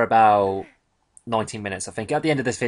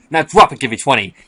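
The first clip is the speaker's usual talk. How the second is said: drill sergeant; 3 semitones higher, 8 dB louder than usual. Pretend. Barking a mock order.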